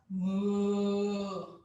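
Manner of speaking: long, low cow moo. Shown as a demonstration. Yoga teacher looking up in cow pose, low mooing. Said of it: "make the moo sound like the cow".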